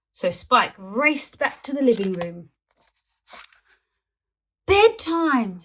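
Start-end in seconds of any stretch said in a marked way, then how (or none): 4.7-5.6 loud, high group shout. A narrator's reading. All the dinosaurs shouting at the boy. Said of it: "shouted all the dinosaurs".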